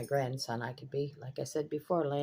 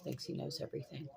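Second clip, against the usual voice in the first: Thinks he knows everything muttered much softer; muttered repeat; 11 dB quieter than usual. Real, not acted. Grandmother grumbling about her grandson, muttered aside. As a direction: mutter it under your breath. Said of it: "He thinks he knows everything".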